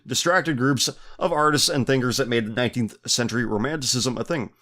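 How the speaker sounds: ordinary talk, nothing marked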